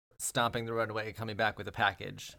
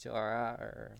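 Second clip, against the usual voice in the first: put-on teasing echo in Australian accent; mock Australian accent; 5 dB quieter, slower than usual. Friend echoing player's vowel, mock Australian accent. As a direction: tease the Australian vowels, imitating. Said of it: "make fun of an Australian accent".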